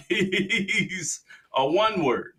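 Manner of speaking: drawn out through a laugh. Real, not acted. Mocking the dad, dragging it out laughing. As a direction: stretch it slow while laughing.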